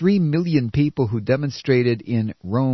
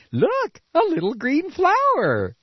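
much higher, lightly voiced sing-song ad voice; mocking an oil ad; 12 semitones higher, slower than usual. Mocking a green commercial in a lightly put-on ad voice. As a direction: parody a cheesy eco commercial. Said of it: "that little green crap".

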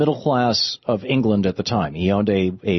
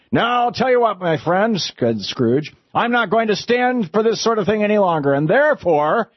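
mock-stern Scrooge; 9 semitones higher than usual. Scrooge pretending to scold Bob before the surprise, high and gruff. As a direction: build up a fake scolding. Scrooge feigns anger at his clerk.

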